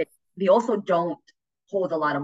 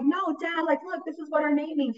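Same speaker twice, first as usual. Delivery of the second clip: much higher, pleading protest; insistent daughter; 10 semitones higher than usual. Retold or imitated. Daughter arguing with dad, high whiny insistence. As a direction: plead your case, whiny. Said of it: "I'm like".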